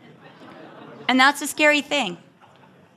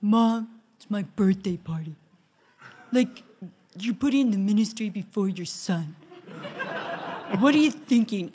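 a lightly voiced teenage son, slightly lower, half laughing; mock a kid guilting his mom, lightly; teenage son's complaint; 3 semitones lower, 4 dB quieter than usual. Son Char scolding mom about his birthday party, lightly voiced teen. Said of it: "Char comes in and goes".

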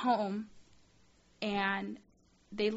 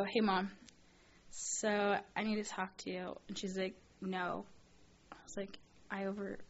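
soft, halting and reluctant; sheepish confession; 6 dB quieter than usual. Retold or imitated. Daughter phoning mom to confess an overdraft, sheepish. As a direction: edge quietly into bad news.

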